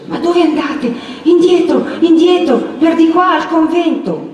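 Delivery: high, frantic warning; frightened boy, pitched far up; trembling, half-faint voice. A narrator's reading. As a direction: urge them back in shaken panic. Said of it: "con voce mezza fioca".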